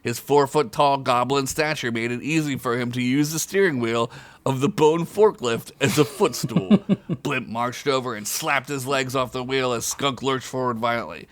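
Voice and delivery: usual speaking voice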